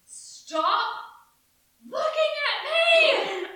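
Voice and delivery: put-on little kid screaming at the staring family; toddler scream. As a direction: shriek like a furious two-year-old. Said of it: "I scream".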